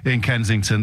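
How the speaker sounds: usual speaking voice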